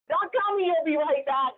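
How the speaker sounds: ordinary talk, nothing marked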